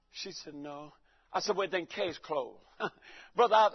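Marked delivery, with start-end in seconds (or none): 0.5-0.9 very soft, low reply; barely voiced, submissive answer; humble wife. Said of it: "my wife was real humble". 1.6-2.6 low, slow and quiet finality; mock-authoritative, dropped low; deeper husband voice. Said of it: "my voice got more deeper".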